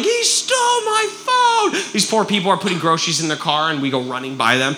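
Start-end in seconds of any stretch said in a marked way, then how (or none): 0.0-1.7 high, drawn-out mock scream; retold yelling. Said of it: "I'm screaming. I'm yelling".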